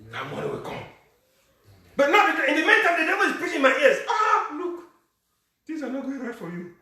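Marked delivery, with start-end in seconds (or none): 1.9-6.7 high, loud, laughing mock of the devil; mocking preaching. Pastor mocking the devil to the church, high laughing.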